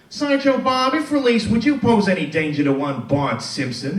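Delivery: high, loud courtroom question; mock lawyer. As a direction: boom it at the witness like a TV lawyer. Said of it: "the lawyer looks at him and goes".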